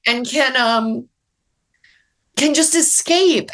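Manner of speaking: pushing through real tears; welling up while explaining why she writes; emotional. Real, not acted. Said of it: "I'm getting emotional".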